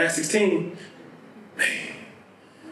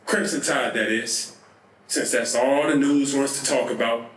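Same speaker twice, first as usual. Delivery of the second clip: normal talking voice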